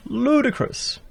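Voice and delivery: high, incredulous echo of ludicrous; scoffing one-word echo, pitched high; outraged repeat. Real, not acted.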